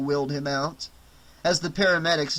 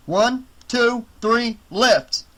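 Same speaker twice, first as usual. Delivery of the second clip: louder, higher count to lift; calling out; 7 semitones higher, 6 dB louder, much slower than usual. Acted. Medic counts off the lift for the team, loud and higher.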